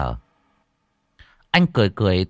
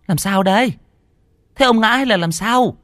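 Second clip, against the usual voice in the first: very high, louder fussy wife protesting; shrill wife voice; 12 semitones higher, 3 dB louder than usual. A narrator's reading. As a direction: squeal at the husband in disgust. Bà vợ hỏi chồng. (giọng bà vợ the thé, kêu ca ghê tởm)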